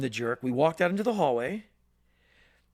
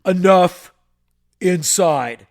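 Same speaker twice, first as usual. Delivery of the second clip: sharp loud command barked out; stern shout; 11 dB louder, slower than usual. Retold or imitated. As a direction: order them inside at full volume. Stern adult ordering kids inside.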